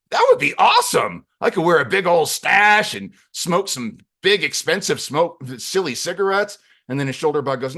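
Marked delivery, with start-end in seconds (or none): 0.1-6.6 high, loud, gushing daydream; excited. Acted. Claudio imagines his yesteryear day, excited. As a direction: burst out thrilled, in a hammed-up high voice.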